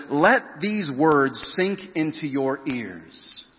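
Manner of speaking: very slow, each word set apart; Jesus demanding full attention, slow and firm. Acted. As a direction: drive the words home slowly. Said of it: "he's saying this really firmly".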